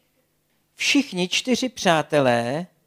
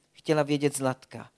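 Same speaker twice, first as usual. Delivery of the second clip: plain reading voice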